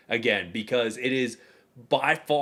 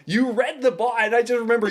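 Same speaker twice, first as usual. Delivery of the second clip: retold yell; 8 semitones higher than usual. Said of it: "And I'm screaming".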